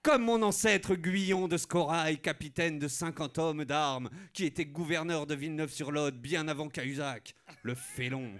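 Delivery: ordinary talk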